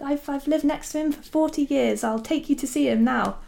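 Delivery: high, keen imitation of an eager neighbour; super enthusiastic. Pat offering to take the visitor next door, super enthusiastic. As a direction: pitch it up and offer help eagerly. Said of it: "she's, like, super enthusiastic".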